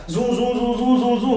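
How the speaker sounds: repeated "zoo" sound effect, evenly strung together; zoom-zoom-zoom of flipping current; imitated zooming noise. Shown as a demonstration. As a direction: mimic rapid switching with a string of zooms. Mimics current rapidly switching polarity.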